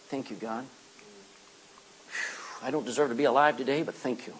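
soft, low murmured prayer; sigh, then thank God quietly; humble thanks. Acted.

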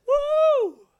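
shouted, very high woo; Paul whooping loudly, high in pitch, with joy; joyful whoop. Retold or imitated. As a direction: whoop with delight. Paul enjoying trouble.

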